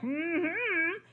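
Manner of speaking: hummed through a mouthful; mouth full. Acted. Crocodile answers while chewing on the king, mouth full. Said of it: "it sounded more like".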